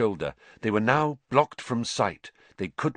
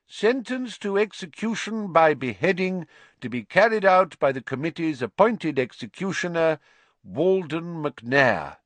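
high, formal reading of a sentence; official proclamation; 7 semitones higher, 3 dB louder than usual. Acted. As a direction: announce the verdict stiffly.

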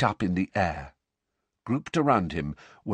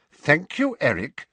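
firm, higher and louder; 7 semitones higher, 4 dB louder than usual. Acted. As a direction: cut the talk short, firmly. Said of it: "said Mister Weasley firmly".

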